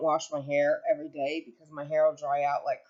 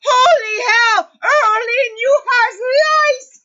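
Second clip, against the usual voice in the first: much higher, loud slow shriek; mother yelling; 14 dB louder, much slower than usual. Retold or imitated. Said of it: "she yells".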